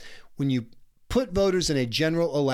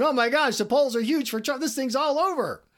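mock poll panic; 11 semitones higher than usual. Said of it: "going on social media and going".

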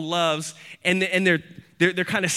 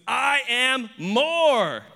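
very slow, raised declaration; emphatic boast; 7 semitones higher, much slower than usual. Acted. Preacher voicing Paul outdoing his rivals, slow emphatic boast. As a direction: stretch each word into a proud boast.

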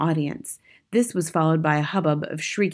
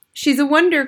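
high, loud adoring exclamation; cried; 10 semitones higher, 6 dB louder than usual. A narrator's reading. Witch audience praising their leader, crying out. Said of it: "cried the audience".

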